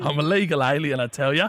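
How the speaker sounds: chatty fake English caller; poor English accent. Prank caller slips song lyrics to cafe, poor English accent. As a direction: ramble on in a badly faked English accent. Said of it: "disregard the piss poor English accent".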